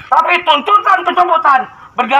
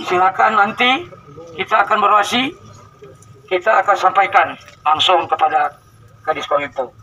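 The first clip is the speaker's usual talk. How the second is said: usual speaking voice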